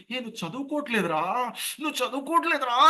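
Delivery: high, strangled mock scolding; choked-back yell. Shown as a demonstration. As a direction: scold with the throat squeezed, afraid neighbours will hear. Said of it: "అని గొంతు నొక్కేస్తాం". (గొంతు నొక్కేసి తిట్టడం)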